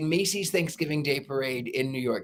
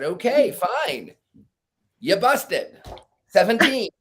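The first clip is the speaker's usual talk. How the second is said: higher, exasperated told-you-so count; exasperated dealer; 5 semitones higher, 4 dB louder than usual. Retold or imitated. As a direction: deal the cards out with a smug, fed-up I-told-you-so. Dealer annoyed at stubborn player.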